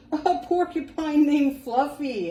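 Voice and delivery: high, loud, laughing howl; howling laughter. Acted. Rhinoceros mocking the porcupine's name, howling with laughter. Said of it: "howled the rhinoceros".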